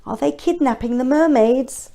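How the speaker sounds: ordinary delivery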